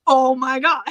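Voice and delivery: high, loud mock-devastated outcry; devastated reaction. Reacting to the devastating last song, high mock devastation. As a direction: blurt it high and loud, laughing round it. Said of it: "It's so devastating".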